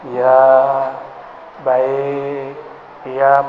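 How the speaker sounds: low, drawn-out obedient replies; dutiful, respectful child. Acted. Santri child answering a rambling parent, respectful and compliant. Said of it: "dia tahu adab kepada orang tuanya".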